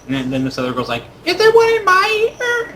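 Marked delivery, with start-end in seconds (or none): 1.3-2.7 put-on very high, loud, slow child voice; eager little girl. Little girl running up for a coin trick, eager. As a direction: beg for a turn in a squeaky little-girl pitch.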